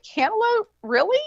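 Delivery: high-pitched disbelief; incredulous question. Retold or imitated. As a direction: question it as if it were absurd. Disbelief at vape flavor.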